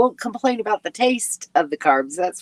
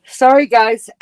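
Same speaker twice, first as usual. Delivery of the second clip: louder, higher, pulled long by a yawn; yawning apology; 5 semitones higher, 8 dB louder than usual. Real, not acted. Host yawning again, apologizing to her viewers. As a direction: let a yawn swallow the words. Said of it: "that's making me yawn".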